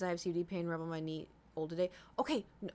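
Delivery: ordinary talk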